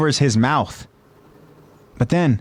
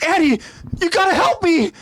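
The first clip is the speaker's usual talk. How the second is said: high desperate plea; desperate cry. Acted. Marco begging Annie in high panic. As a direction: call to her for rescue. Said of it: "Marco cries out".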